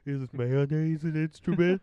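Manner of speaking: slow, high, loud cartoon dope; big goofy cartoon question; cartoon quote. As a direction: draw it out loudly like a dim cartoon character. Mimicking cartoon quote just said.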